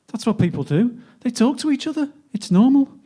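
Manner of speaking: high, insistent self-defence; defensive protest, pitched well up; defensive retort. Acted. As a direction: justify yourself, rising in pitch and volume. Acted quarrel in poem.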